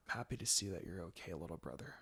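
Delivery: soft breathy whisper to a sleeping brother; hushed whisper at a bedside. A narrator's reading. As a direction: whisper it so he stays asleep. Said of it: "she whispered".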